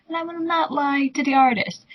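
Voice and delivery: ordinary talk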